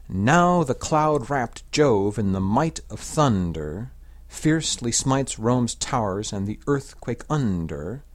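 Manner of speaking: slow verse, leaning on the beats in "Now the cloud-wrapped Jove"; exaggerated English ictus. Shown as a demonstration. Teacher reads the English sapphics translation, ictus exaggerated a bit. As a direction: scan it slowly, pressing each beat through "thunder", "under", "asunder". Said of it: "exaggerate the ictus a bit".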